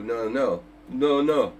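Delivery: ordinary talk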